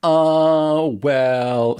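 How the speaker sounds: drawn-out, noncommittal stalling; mock wishy-washy hesitation. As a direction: imitate someone hedging for time. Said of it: "like, wishy-washy answers".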